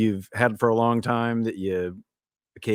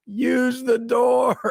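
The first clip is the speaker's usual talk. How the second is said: a big hammed-up yell, much higher and slow; mock yell; 12 semitones higher, much slower than usual. Retelling the neighbor's reply through the wall, a mock yell. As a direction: bellow it out slow like an annoyed neighbor. Neighbor's retort in party story.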